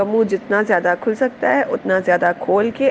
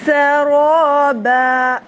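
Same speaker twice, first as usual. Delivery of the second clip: slow, drawn-out chanted recitation, pitched higher; Quran recitation; 5 semitones higher, 5 dB louder, much slower than usual. Shown as a demonstration. Teacher opening the lesson with slow chanted recitation. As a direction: recite each word slowly in melodic tajweed. Recites next word in tajweed.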